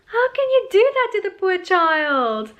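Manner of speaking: high, loud, hammed-up indignation; outraged protest. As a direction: wail it in appalled disbelief. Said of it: "I was like".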